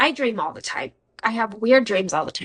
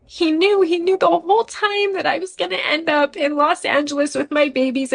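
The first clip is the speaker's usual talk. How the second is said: overcome realization; 7 semitones higher than usual. Real, not acted. Emotional realization, voice breaking.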